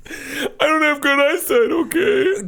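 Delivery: high, wailing mock cry; fake crying kid. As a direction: whine it like an offended child. Giant kid gets upset.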